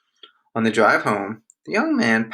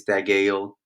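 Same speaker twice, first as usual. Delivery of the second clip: plain reading voice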